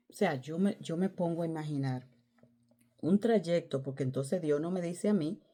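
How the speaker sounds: ordinary talk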